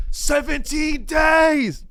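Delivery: high, drawn-out movie-climax yell; acted anguished shout. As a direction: shout it slowly like a hammed-up escaped hostage. Escaped victim tells the cop.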